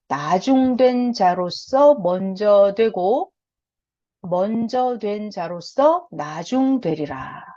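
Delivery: very slow, weighty scripture reading; slow solemn recital. Shown as a demonstration. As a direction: read each word out slowly. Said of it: "자, 이거 잘 들어요".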